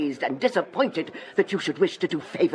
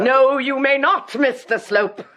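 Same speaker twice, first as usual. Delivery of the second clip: stern refusal; 6 semitones higher, 5 dB louder than usual. Acted. Mrs Proudie cutting him off.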